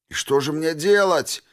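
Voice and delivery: high, desperate yell; shouting. Acted. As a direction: scream it at the sky. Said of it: "закричал дезертир монстром".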